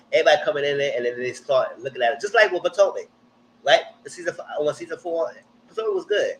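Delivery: ordinary delivery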